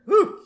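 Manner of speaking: loud high relieved exhale; relieved whew. Real, not acted. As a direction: blow out after a sneeze. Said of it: "had to sneeze".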